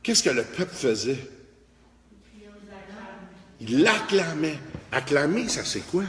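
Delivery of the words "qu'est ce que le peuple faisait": ordinary talk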